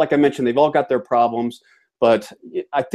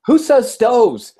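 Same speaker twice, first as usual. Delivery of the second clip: high, loud, incredulous challenge; drawn-out, high-pitched disbelief; mock indignation; 12 semitones higher, 5 dB louder, much slower than usual. Mock outrage at word stoves.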